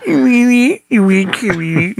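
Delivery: squeaky tinny can voice. Retold or imitated. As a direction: sound like a voice through a tin can. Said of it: "And it's that tinny voice".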